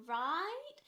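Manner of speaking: exaggerated Canadian cashier; heavy Canadian accent. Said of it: "she has this really heavy Canadian accent".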